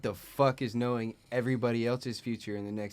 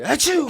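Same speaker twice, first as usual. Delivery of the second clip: big hammed-up sneeze, high and loud; loud fake sneeze; 10 dB louder than usual. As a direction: belt out a theatrical achoo. Acting out a butterfly sneeze.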